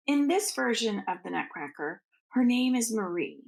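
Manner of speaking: usual speaking voice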